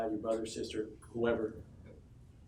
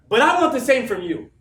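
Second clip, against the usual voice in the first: louder, higher, insisting; firm shout; 8 semitones higher, 11 dB louder than usual. Real, not acted. Speaker to his team, loudly demanding the same. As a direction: demand it back from them.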